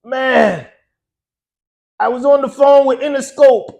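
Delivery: put-on high, loud Caribbean-accented phone talk; island accent. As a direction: do the island DJ breaking news, pitched well up. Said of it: "So he still got that accent".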